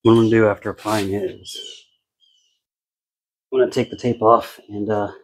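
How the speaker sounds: ordinary delivery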